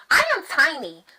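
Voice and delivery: ordinary delivery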